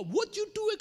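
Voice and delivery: loud, high, snapped officer's challenge; barked question. Retold or imitated. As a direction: bark it like a stern border officer. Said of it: "ketika digebrak". (petugas membentak dengan keras)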